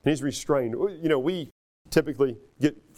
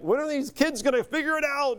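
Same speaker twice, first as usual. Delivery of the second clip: mock exasperated teacher, pitched higher; 9 semitones higher than usual. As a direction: toss it up high like a fed-up teacher. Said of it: "we're like".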